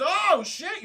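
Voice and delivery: high, loud mock surprise; startled. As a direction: blurt it as if caught red-handed. Caught man startled by arrival.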